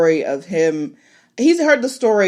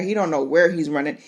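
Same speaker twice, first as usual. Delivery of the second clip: ordinary talk, nothing marked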